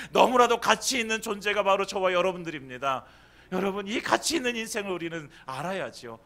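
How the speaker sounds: fervent preaching. Acted. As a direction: preach it with rising fire. Preaching at full force.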